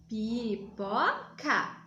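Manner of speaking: very slow Pipoca, syllable by syllable; syllables spelt out. Shown as a demonstration. Teacher says Pipoca slowly so children count vowels. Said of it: "Então, vamos lá".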